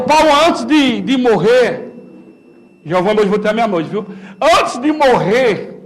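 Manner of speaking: normal talking voice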